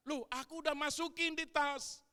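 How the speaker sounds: loud, high protest; husband raising voice in self-defense; defensive husband. Retold or imitated. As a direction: insist you already packed it. Husband defending himself in quarrel.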